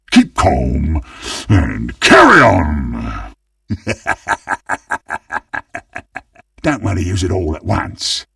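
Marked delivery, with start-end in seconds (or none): 3.7-6.2 soft, long staccato chuckle; quiet, sinister run of laughs; villain chuckle. Acted. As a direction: chuckle on and on under the breath.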